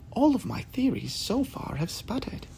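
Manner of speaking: a hint of a deflated sidekick; Watson. Watson admits to Sherlock her theories failed, softly. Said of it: "Watson uttered".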